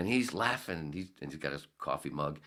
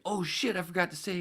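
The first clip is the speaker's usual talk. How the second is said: high startled oh shit; mock startled oops; sudden realisation; 3 dB louder than usual. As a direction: catch yourself, high and sudden. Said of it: "I was like".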